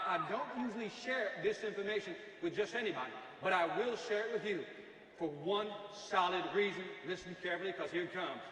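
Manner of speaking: usual speaking voice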